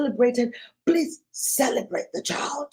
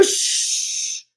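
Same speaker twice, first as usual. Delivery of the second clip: hushing. Acted. Parent hushing child.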